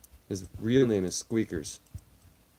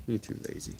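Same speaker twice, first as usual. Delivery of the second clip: unvoiced whisper, softer; whispered tease; 8 dB quieter, faster than usual. Real, not acted. Streamer teasing lazy cat, whispered.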